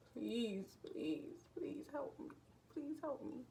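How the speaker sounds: breathy whispered prayer, begging softly; whispered plea. Retold or imitated. Praying to the Lord on a heavy day, a whispered plea.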